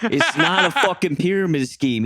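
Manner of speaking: high, loud retold protest; mock indignant outburst. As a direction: imitate a defensive coworker raising his voice. Coworker bristles at scheme accusation.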